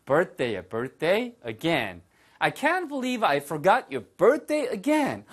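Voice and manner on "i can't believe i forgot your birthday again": high, slow, dismayed line; dismayed disbelief. Shown as a demonstration. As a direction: sound shocked at forgetting. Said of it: "이런 식의 느낌인 거죠". (높고 느리게 어이없어하는 말투)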